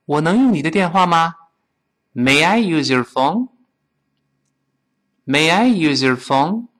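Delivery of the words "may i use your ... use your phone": slowly and evenly, rising at the end; careful, spaced question for repeating; slow model reading. Shown as a demonstration. Teacher leads students through the question, slow model reading. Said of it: "我们先跟老师慢慢再读一遍".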